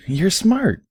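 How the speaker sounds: put-on high, far-off girlish pony voice, anxious; Derpy's voice. Derpy asking Barry in the bath, high and anxious.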